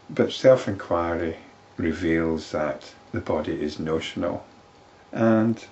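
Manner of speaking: ordinary delivery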